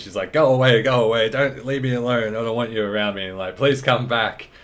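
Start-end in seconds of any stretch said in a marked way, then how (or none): 0.3-3.3 loud, pushy go away, imitating her; mock angry brush-off; mimicking angry rejection. Player retelling Mary's outburst, mildly mock angry. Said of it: "she just had that split mood change". 3.6-4.5 a light mock plea to come back; mimicking pleading. Player retelling Mary's sudden plea, lightly pleading. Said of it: "split mood change".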